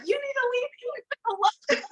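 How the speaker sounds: high-pitched retold warning; mock protective scolding. Commenter defending him in an argument, mock scolding.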